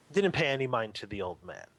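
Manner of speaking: ordinary talk, nothing marked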